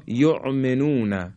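یؤمنون slowly with ع replacing the hamza; hamza as ayn. Shown as a demonstration. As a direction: squeeze an ayn into the middle of یؤمنون. (یؤمنون با عین به جای همزه)

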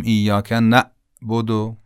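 "نعبد" broken by a glottal stop after نَ; hamza stop. Shown as a demonstration. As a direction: cut the sound dead inside "نعبد". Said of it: "یعنی صدامون گیر کرد، حبس شد".

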